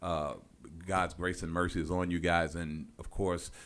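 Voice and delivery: quiet, sincere memorial words; solemn. Real, not acted. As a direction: speak gently for the grieving family. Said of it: "let me take a solemn moment".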